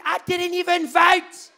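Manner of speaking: very high, slow and loud; disbelieving panic. Retold or imitated. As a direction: drag out in shock. Repeated line.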